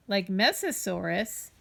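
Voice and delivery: ordinary delivery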